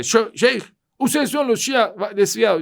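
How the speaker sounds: high, loud accusing outburst; indignant accusation. Retold or imitated. Young Wahhabi accusing the sheikh's community, high indignant accusation. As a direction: fling the charge at him.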